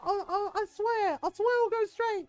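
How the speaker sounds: high, stammering imitation of a pleading captive; mock begging. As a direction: swear reform in a hammed-up British caricature. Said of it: "he keeps crying and begging".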